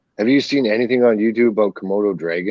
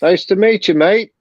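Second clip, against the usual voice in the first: a higher, playful attempt at an Australian accent; mock Australian accent; 9 semitones higher, 4 dB louder than usual. Greeting an Australian viewer in a mock Australian accent. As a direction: greet the Aussie in a half-baked Australian accent. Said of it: "that's the close accent I get".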